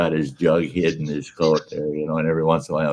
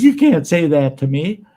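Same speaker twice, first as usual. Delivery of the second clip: indignant; 8 semitones higher, 6 dB louder than usual. Retold or imitated. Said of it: "She says".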